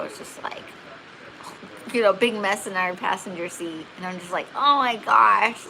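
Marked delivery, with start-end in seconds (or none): 4.5-5.7 retold dismay. Dismay at water breaking in car.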